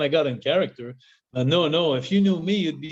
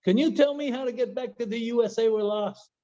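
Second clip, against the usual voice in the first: much higher, polite asking voice; timid lost driver; 8 semitones higher than usual. Retold or imitated. Lost American driver asking the Mountie the way, high and timid. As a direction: ask meekly, way up in pitch. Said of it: "looks back up to me and says".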